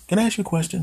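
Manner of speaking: high-pitched cartoon animal voice; animal character. Talking animal asking the man a question, high voice. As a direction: ask in a squeaky put-on voice.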